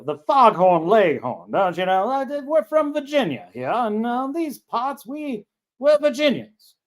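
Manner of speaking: high, drawn-out mock Virginia accent; Foghorn Leghorn. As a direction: ham up an old Virginia Southern drawl. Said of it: "the Virginia accent, don't you know".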